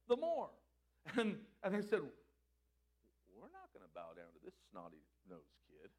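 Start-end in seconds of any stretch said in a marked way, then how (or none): 3.3-5.9 low, hushed grumbling; scornful grumble. Acted. Brothers refusing to bow to Joseph, scornful grumble.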